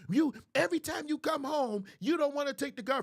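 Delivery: high-pitched acted scolding; aggressive nagging. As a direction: lay into him like a fed-up wife. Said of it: "first of all, you too aggressive".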